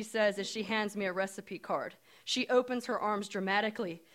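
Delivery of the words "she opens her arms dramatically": ordinary delivery, nothing marked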